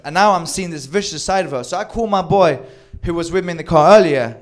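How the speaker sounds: ordinary delivery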